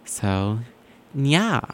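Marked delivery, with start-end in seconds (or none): 1.1-1.6 cat-like nya. Real, not acted. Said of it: "I don't know why I said nya".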